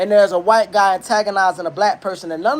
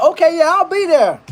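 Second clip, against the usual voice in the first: higher, louder, too-eager agreement; eager high yes-man voice; 10 semitones higher, 4 dB louder than usual. Retold or imitated. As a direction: chirp it like a smitten guy. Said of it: "And you like".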